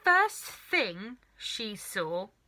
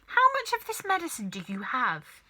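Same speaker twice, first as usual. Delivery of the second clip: a touch louder, eager asking; eager question; 5 semitones higher, 4 dB louder than usual. A narrator's reading. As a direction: press for an answer. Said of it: "Mister Cranky shouted".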